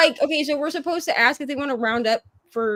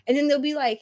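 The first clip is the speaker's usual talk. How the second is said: ordinary delivery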